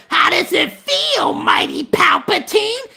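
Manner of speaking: high, slow cartoon villain taunt; hammed-up villain sneer; taunting general. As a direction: draw it out high and mocking. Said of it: "one of the generals might come".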